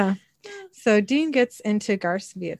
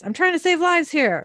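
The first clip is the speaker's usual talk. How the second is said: raised-pitch, lightly acted frantic protest; 9 semitones higher, 4 dB louder than usual. Garth protests to Dean on the phone, lightly acted frantic. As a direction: protest as a frantic hunter, in recap.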